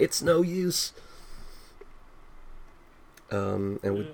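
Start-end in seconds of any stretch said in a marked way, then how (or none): 0.0-0.9 a lightly voiced Silver, higher; Silver's catchphrase. Quoting Silver from Sonic oh six, lightly imitated. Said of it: "as he would say in Sonic oh six".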